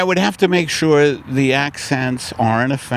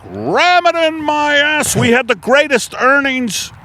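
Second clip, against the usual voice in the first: much higher mocking executive voice; mock Shell executive; 12 semitones higher than usual. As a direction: lampoon a greedy oil boss. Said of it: "like the Shell executives".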